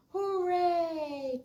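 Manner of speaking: high, loud elephant cheer; trumpeting cheer. Acted. Oliver cheers loudly about the new tree house. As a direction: trumpet it out with joy. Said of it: "trumpeted Oliver".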